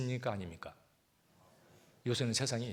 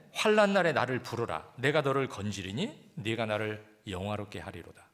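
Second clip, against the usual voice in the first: loud, ringing scripture reading from the pulpit; preaching the verse out loud; 5 dB louder than usual. Acted. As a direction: proclaim the verse to the whole hall. Reads verse again, loud.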